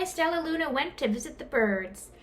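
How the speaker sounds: ordinary delivery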